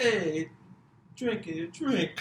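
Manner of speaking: high, loud mock New York accent. Shown as a demonstration. Goading the other comic to try, loud New York accent. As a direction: holler it in a badly faked New York accent. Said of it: "I'll do mine right now".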